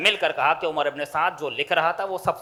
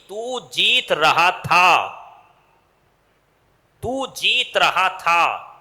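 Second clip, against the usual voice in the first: slow dramatic build; 5 dB louder, much slower than usual. Acted.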